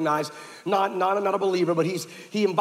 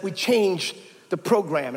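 каждое слово медленно и весомо; slow announcement; slower than usual. Retold or imitated. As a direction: drag it out slowly, weighty. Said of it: "looks at me and says".

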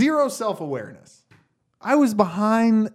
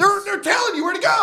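much higher, exasperated cry; exasperated outburst. Real, not acted. Driver ranting about ignored lane arrows, high exasperated outburst.

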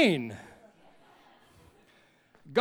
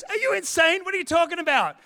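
high, loud disbelieving cry; incredulous extrovert; 9 semitones higher, 5 dB louder than usual. Retold or imitated. Extrovert yelling at his couch-bound friend, high and outraged. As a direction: squeal it like he can't believe his ears. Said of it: "And he goes".

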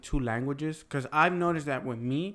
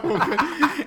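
loud high laugh breaking out; burst of laughter; 13 semitones higher, 8 dB louder than usual. Real, not acted. Laughing at his own frustration with his uncle, loud and high.

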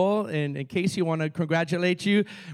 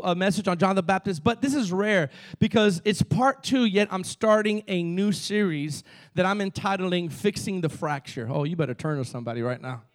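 ordinary talk

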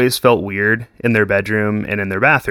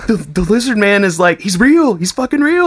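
very high, urgent insistence; insisting, excited; 10 semitones higher than usual. Real, not acted. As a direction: convince them it's real. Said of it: "insisting it's real".

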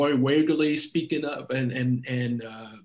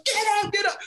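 very high shouted command; shouting coach; faster than usual. Retold or imitated. Coach Bora shouting at the sub to get up. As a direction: bark him off the bench. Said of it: "he's like".

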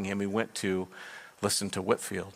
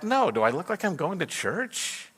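scoffing inner reply; 8 semitones higher than usual. Retold or imitated. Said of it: "in his heart, he was like".

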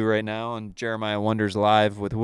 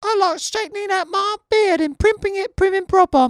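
very high, loud falsetto; hammed-up prim and proper falsetto; prim character voice; 6 dB louder than usual. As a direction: introduce yourself in a piercing, proper little falsetto. Performs a high, prim character introduction.

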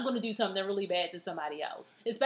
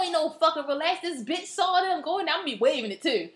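ordinary talk

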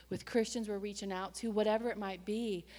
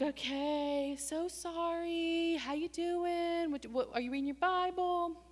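mock sweet; 8 semitones higher, slower than usual. Mock sweet reply to congregant.

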